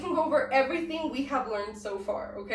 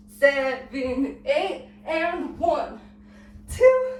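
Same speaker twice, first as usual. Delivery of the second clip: dance count; much slower than usual. Shown as a demonstration. Dance teacher leading a recap from the top, rhythmic counting.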